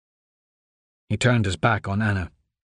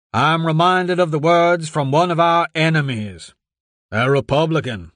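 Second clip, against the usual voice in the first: pompous senator oratory; 6 semitones higher, 3 dB louder than usual. A narrator's reading. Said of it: "voice continued to roar through the microphone".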